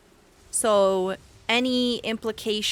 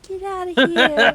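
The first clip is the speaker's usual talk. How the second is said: shooing; 8 semitones higher, 8 dB louder than usual. Real, not acted. Shooing the cat Momo.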